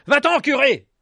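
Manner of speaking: high, loud order to leave; angry shout. A narrator's reading. Villager ordering the priest away, angry shout. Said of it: "un autre ajouta".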